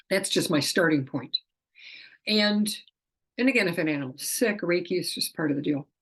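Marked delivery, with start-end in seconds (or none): none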